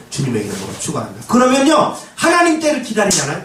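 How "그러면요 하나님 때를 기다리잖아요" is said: preaching shout. Real, not acted. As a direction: call it out to the pews. Preacher exclaims to congregation.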